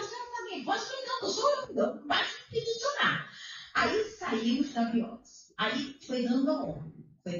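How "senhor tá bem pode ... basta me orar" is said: high, faltering imitated prayer of the husband; husband praying. Wife imitating her weak husband praying after the biopsy. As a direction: mimic his weak, confused prayer up high. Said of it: "Ele começou a orar".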